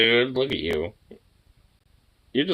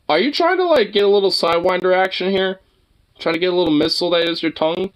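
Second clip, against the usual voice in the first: playful high scolding; 9 semitones higher, 3 dB louder than usual. Real, not acted.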